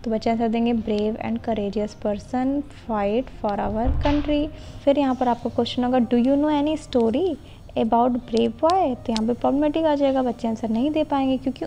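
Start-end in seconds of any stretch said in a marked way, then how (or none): 0.7-4.5 very slow, every word spaced for note-taking; slow dictation. Shown as a demonstration. Teacher dictating a model question to trainee teachers, very slow. 6.0-8.9 very slow, every word spaced for note-taking; slow dictation. Shown as a demonstration. Teacher dictating a model question to trainee teachers, very slow.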